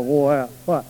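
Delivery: ordinary talk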